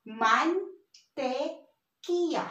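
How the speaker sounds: mantequilla very slowly, broken into man, te, qui-lla; syllable by syllable. Shown as a demonstration. Teacher builds up the word for learners, slow separated syllables. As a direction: draw out each syllable of mantequilla with pauses. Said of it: "So this is".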